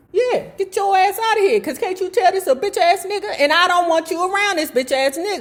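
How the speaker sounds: high, fast hammed-up sneering rant; mock the dad mocking the son. Host mock-voicing dad, cussing.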